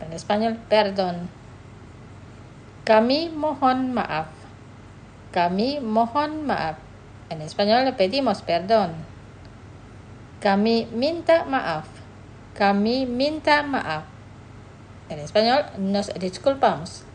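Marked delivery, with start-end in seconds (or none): 2.8-6.8 "Kami mohon maaf" said slowly twice, each syllable clear; slow model phrase. Shown as a demonstration. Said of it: "Los pronunciaré despacio". 10.4-14.0 "Kami minta maaf" said slowly twice, each syllable clear; slow model phrase. Shown as a demonstration. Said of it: "Los pronunciaré despacio".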